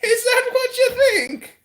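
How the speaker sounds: very high, laughing, crying voice; leprechaun. Pretend. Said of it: "He does sound like a leprechaun".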